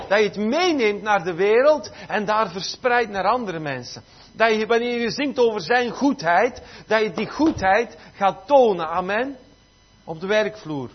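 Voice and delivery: impassioned sermon with punched key words; forceful preaching. Real, not acted. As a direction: drive it home to the congregation.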